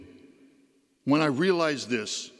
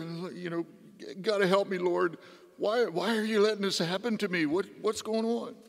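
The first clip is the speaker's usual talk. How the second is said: higher-pitched, put-upon complaint; acted pleading; 4 semitones higher than usual. Sleepless patient begging the Lord for rest, acted pleading.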